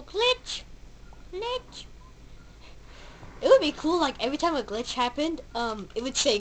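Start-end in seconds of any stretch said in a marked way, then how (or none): 0.0-0.6 Glitch squeaked high with a rising tune; high squeaky Glitch. Pretend. Echoing the game's word. 1.3-1.8 Glitch squeaked high but much softer, rising; whisper-soft echo of Glitch, still high. Pretend. Echoing the game's word.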